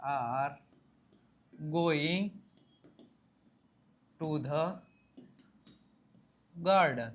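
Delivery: slowly, word by word with pauses; slow teaching model; slow drill. Shown as a demonstration. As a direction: lay out each word for learners. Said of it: "અમે garden જઈ રહ્યા છીએ".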